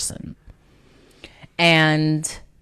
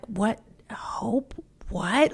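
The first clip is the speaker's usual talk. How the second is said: hushed, half-asleep whisper; groggy whisper; 6 semitones higher, 7 dB quieter, slower than usual. Retold or imitated. Friend woken at two am, sleepy confused whisper. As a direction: sound just woken and confused.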